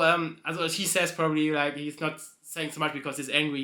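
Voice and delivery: ordinary talk, nothing marked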